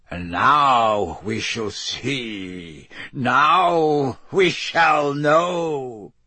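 very slow, building villain declaration; ominous anticipation. Acted. Dark lord about to summon followers, slow and ominously building. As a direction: stretch the words, rising to a climax.

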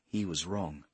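usual speaking voice

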